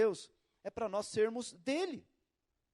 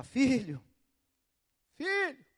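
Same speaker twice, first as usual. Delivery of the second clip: higher, louder gentle call; God calling; 4 semitones higher, 7 dB louder, much slower than usual. Retold or imitated. God calling his child, gentle raised call. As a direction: call out to a child you love. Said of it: "Deus tá lá".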